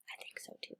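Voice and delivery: usual speaking voice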